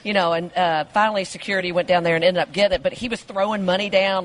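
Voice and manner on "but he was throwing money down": ordinary delivery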